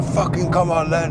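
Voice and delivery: high, aggressive call to fight in a mock Eastern European accent; Eastern European challenge. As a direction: square up like the thief daring him to fight. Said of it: "like, Eastern European or something".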